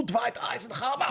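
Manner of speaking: high, hammed-up Austrian accent; Arnie accent. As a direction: do a playful Schwarzenegger-style Austrian accent. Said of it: "I can do an Arnie accent".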